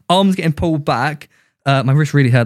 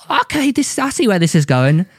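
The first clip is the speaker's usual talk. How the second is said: higher-pitched, extra-British English; more British accent; 7 semitones higher than usual. Retold or imitated. Replying to the bouncer in the story, extra British. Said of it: "make your accent sound more British".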